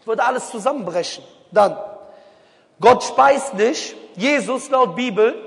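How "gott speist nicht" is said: slow, loud and weighty; slow punchy declaration; emphatic statement. Real, not acted. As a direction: lean hard on every word. Preacher stressing a point.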